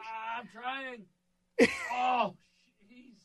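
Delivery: slow, high, whimpering in pain; pained crying. Real, not acted. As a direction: whimper through the cramp. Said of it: "worst leg cramp".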